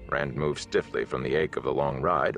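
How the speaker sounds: ordinary delivery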